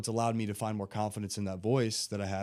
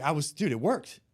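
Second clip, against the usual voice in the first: higher, louder, excited client; retold client's triumph, raised pitch; excited retelling; 5 semitones higher, 4 dB louder than usual. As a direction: report the win with excitement. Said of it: "He was so excited".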